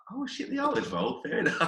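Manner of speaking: sudden high-pitched realisation; hammed-up startled high voice, then settling; mock surprise. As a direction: squeak it out as if it just dawned on you. Retold moment of realisation.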